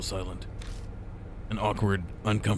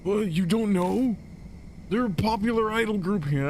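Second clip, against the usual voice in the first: high squeaky character voice; 11 semitones higher than usual. Acted. Same character voice returns.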